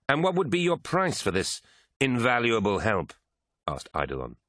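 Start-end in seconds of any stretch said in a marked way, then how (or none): 0.1-3.1 high-pitched, scornful query; sardonic question. A narrator's reading. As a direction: ask it with haughty sarcasm. Hostile commander mocks traitor's offer.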